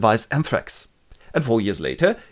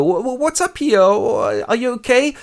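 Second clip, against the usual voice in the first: high, loud flustered bystander; bystander's alarm; 12 semitones higher, 4 dB louder than usual. Retold or imitated. Passers-by ask the fallen mother what's wrong, flustered. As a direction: stammer out the concern in a high put-on voice. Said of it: "some people come up and go".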